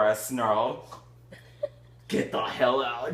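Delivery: ordinary delivery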